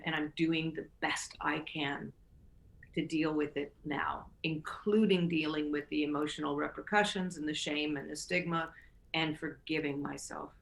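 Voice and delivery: near whisper, soft and inward; whispered self-talk. Shown as a demonstration. Host modelling self-forgiveness for Rosanna, nearly whispered. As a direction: breathe it to yourself.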